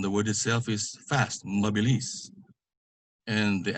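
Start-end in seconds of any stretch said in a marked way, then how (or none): 1.4-2.3 MABILIS quick, weight on final LIS; final-syllable stress. Shown as a demonstration. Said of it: "put the stress on the last syllable".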